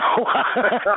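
bursts into a giggle; short real cackle; high laugh. Real, not acted. Laughs at own joke.

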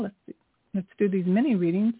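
ordinary delivery

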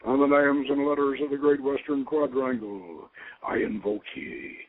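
grave ceremonial calling of the watchtower; ritual invocation. Real, not acted. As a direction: invoke the angels of the west with ceremony.

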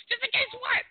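mock disbelief; incredulous. Son mocking his mom's excuse, incredulous.

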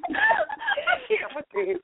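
squealing through laughter; slow, high, laughing disbelief. Real, not acted. Overwhelmed with laughter.

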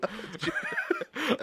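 squeaky high-pitched giggle. Real, not acted. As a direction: break into a high laugh. Laughs at own childhood memory.

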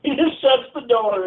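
high, loud excited retelling; telling it with rising excitement. Retold or imitated.

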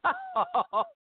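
high squealing laugh. Real, not acted. Big laugh at the joke.

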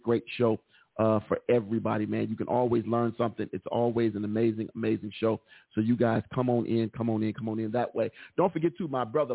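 ordinary talk

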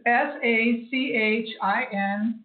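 S A C H I N, slow separate letters; spelling it out slowly. Shown as a demonstration. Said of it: "How do you spell that again?".

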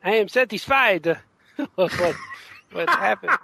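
a hint of a content, well-fed colleague; Francisco Francavilla. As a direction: friend echoing a comic artist's after-dinner line, mild mock content. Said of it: "who's that an imitation of".

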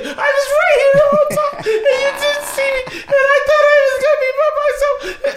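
very high, loud wailing; mock bawling child. Little sister finally found by brother, wailing in mock hurt. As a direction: blubber like an abandoned little girl, hammed-up. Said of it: "She starts crying".

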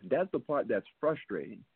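usual speaking voice

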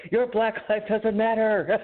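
slow, high-pitched mock yell; mock scream. Man imagining screaming at his nice neighbor, hammed-up mock scream. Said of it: "I just screamed at her".

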